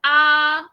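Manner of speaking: flat sustained आ; level first tone. Shown as a demonstration. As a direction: keep आ on one steady pitch. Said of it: "first कैसी थी?".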